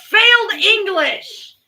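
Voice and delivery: high, slow, loud teasing yell; teasing shout. Real, not acted. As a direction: shout the tease at him. Teasing partner loudly.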